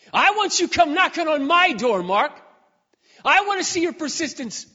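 God calling out, urging him to come knocking. Retold or imitated.

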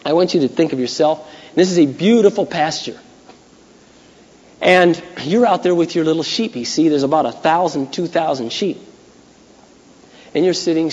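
ordinary talk

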